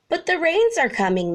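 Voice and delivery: high, quick squawking birds; fast high bird squawk. A narrator's reading. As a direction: flamingos squawk out a warning. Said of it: "they squawked".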